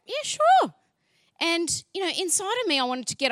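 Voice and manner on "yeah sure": surprised agreement. Retold or imitated. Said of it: "I was like".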